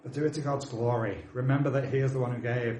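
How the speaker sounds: ordinary talk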